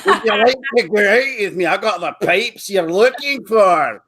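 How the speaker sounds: high, hammed-up Scottish brogue; Scottish accent. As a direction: chat up a lassie in broad Scottish. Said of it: "I've tried Scottish. It doesn't work. Go to clubs, go".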